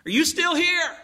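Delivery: high-pitched call into the distance. Acted. Husband calls into driving rain, high searching shout. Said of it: "And he calls out".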